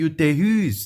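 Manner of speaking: "Utérus" with RUS pushed up; last syllable stressed. Shown as a demonstration. Teacher showing students the strong ending, final stress. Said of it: "que é a mais forte".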